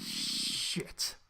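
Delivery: low, whispered, slow dismay; breathy stunned shit. Real, not acted. Friend recalling the murder scene, whispered dismay. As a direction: let it out under the breath.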